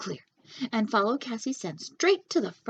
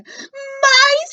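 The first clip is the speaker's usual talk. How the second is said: very high, loud scream; a little girl shrieking at mice; 13 dB louder than usual. A narrator's reading. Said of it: "screamed a little girl".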